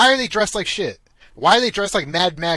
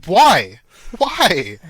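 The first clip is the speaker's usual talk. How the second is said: louder, pleading why; exasperated why; 2 semitones higher, 7 dB louder than usual. Retold or imitated. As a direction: throw up your hands, demanding a reason. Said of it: "I'm like".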